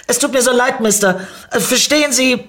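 high-pitched, loud pleading; flustered apology. Acted. Narrator apologises to the angry car owner, flustered.

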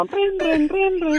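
high, repeated ringing noise; imitated phone ringing. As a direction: ring like a phone, pitched high.

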